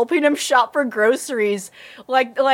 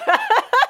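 laugh; 12 semitones higher than usual. Real, not acted. Laughs at skipping names.

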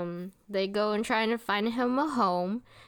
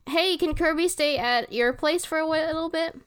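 high-pitched mock request; imitating Tiff; 7 semitones higher than usual. Recapping Tiff asking a villager, high imitation. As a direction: ask in a high character voice.

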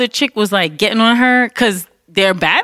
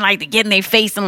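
normal talking voice

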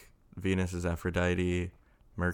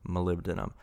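usual speaking voice

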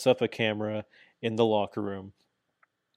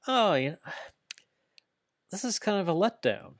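disappointed sigh; 9 semitones higher than usual. Retold or imitated. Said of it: "I'm just like".

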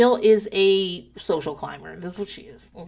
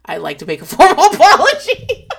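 laughing through a husband's stiff formal apology; mock formal apology; 7 semitones higher, 10 dB louder than usual. Husband to wife, a mock formal apology with laughter. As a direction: ham up his stiff apology, breaking into laughter. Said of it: "And he goes".

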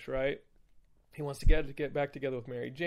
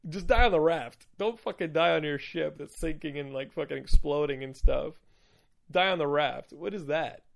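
loud, incredulous mock rant; mock exasperation; 2 semitones higher, 7 dB louder than usual. Podcaster berating Spider-Man over Doc Ock, mock exasperated. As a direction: rant in comic disbelief.

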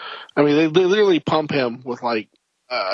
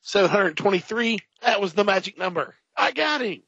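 much higher, gleeful cry; mock triumph; 8 semitones higher than usual. Soldier cheering the magic shot, high and gleeful. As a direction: celebrate the lucky final bullet.

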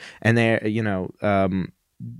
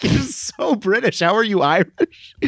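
mock exasperation; 10 semitones higher, slower than usual.

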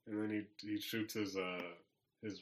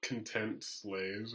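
ordinary delivery

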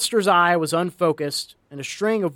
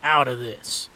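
a slow menacing growl, close to his face; 2 semitones lower, slower than usual. A narrator's reading. Jack to the dying Cyclops, a slow growled warning. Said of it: "he growled".

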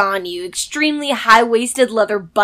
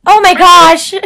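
loud, high surprised exclaim; real delighted shock; 6 semitones higher, 9 dB louder than usual. Real, not acted. Hearing she was nineteen.

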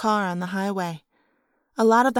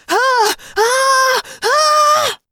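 high, loud screams climbing higher; screaming; 7 dB louder, much slower than usual. Acted. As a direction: scream in mounting panic. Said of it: "the boy screamed".

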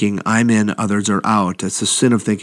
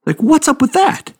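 mock indignant. Mock outrage at the party.

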